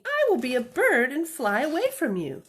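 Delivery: high, loud little bunny. Acted. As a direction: play the runaway bunny, bright and eager. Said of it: "said the little bunny".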